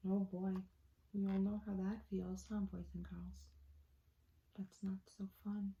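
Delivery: put-on soft, near-whisper aside to the kids; hushed aside. Reader comforting the children, hushed. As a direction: whisper it to the listeners.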